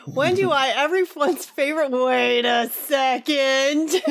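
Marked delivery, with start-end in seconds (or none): 1.9-4.0 very slow, drawn-out realisation; dawning suspicion. Real, not acted. Reader catching on to the question's sender, dawning suspicion. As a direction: stop and stretch every word out suspiciously.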